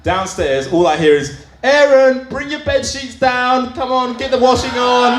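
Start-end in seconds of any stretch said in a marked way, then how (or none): 1.6-5.1 mum calling up. Retold or imitated. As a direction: call him down like a mother.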